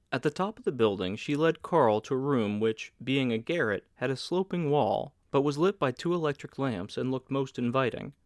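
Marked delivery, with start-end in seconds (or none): none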